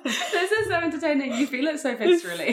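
high laughing through words. Real, not acted. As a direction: giggle out each word. Both laughing at her reaction.

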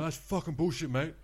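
high, indignant retold protest; mock outrage. Drunk gambler disputing the spin to his dealer, mock outrage. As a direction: act out a gambler crying foul. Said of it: "then he's gone spastic".

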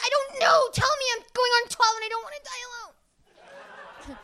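high mock wail; mock desperate. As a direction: beg like a lonely preteen. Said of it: "And you're like".

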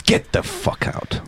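quiet menace. Acted. Hoover shopkeeper to customer wanting a dustpan, quiet menace. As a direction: drop it to a slow, hushed threat.

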